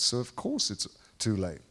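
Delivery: ordinary talk, nothing marked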